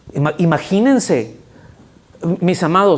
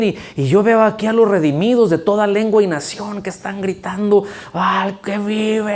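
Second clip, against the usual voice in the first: preaching at full force; 6 semitones higher than usual. Acted. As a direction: lift the crowd with awe.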